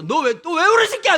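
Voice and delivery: put-on loud, high scolding shout at a crying boy; bellowing father scolding his whiny son; father's angry yell. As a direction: yell at the kid like a fed-up dad.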